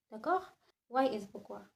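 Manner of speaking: ordinary talk, nothing marked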